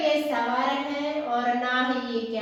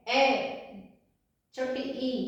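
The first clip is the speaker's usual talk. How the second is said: normal talking voice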